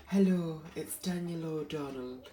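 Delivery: a hint of a posh singer; mild posh impression of a singer; posh imitation. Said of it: "sounds very posh".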